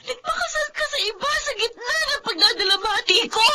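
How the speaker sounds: soft, choked crying rebuke; crying accusation. Acted. Trisha confronts Rodel who married another, crying. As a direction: accuse him through tears.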